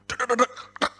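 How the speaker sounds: high staccato mouth-made banging; comic rattling "dek-dek" effect; imitated hang-up noise. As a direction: mimic a receiver clattering down. Imitates phone being hung up.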